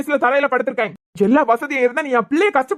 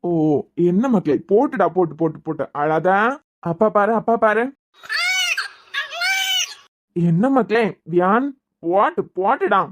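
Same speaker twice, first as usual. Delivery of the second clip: put-on soothing baby; 4 semitones lower than usual. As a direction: lull the baby gently. Father hushing crying baby.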